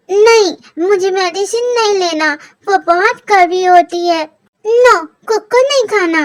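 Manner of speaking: put-on a sulky kid pushing the medicine away; whiny child.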